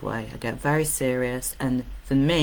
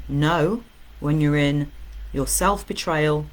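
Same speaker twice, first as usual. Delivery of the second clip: normal talking voice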